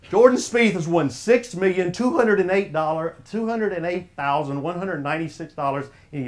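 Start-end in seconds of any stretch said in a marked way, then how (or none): none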